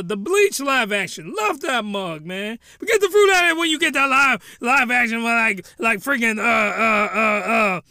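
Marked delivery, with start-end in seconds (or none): 2.8-5.2 ranting. Real, not acted. Ranting outburst.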